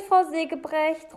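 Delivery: high, loud call. A narrator's reading. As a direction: call her name from another room. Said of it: "ruft er dann".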